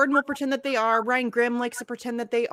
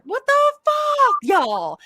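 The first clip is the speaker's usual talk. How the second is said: much higher and louder, slowed; outraged shriek; 12 semitones higher, 4 dB louder, slower than usual. Real, not acted. To the audience about calling Pelosi Mama Bear, an outraged high shriek. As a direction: shriek it in disbelief.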